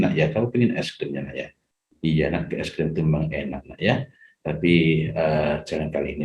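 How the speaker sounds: put-on soft, patient no to a pleading child; gently firm parent. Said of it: "Nah, itu tegas". (ayah menolak es krim dengan lembut dan sabar)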